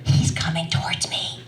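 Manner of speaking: acted breathy stage whisper; urgent whisper. To her sister as the stranger approaches, urgent whisper. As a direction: whisper a secret warning into the phone.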